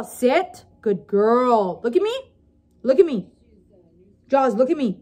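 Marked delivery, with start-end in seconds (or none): none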